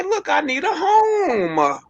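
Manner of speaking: a high, slow whiny plea for a place to stay; high, slow mock plea. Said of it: "But then just say".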